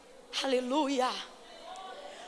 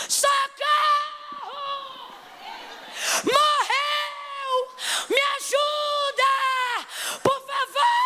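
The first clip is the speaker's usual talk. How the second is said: shrieking for help, much higher, in panic; panicked screaming; 10 semitones higher, much slower than usual. Pretend. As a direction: scream it like a mother finding her dead son. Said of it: "sabe o que ela faz?". (gritos desesperados de pânico)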